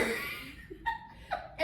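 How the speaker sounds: high, breaking burst of laughter; laughing. Real, not acted. Wife laughing at her husband's mouse grief, real laughter. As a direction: crack up helplessly at your own punchline. Cracks up after the punchline.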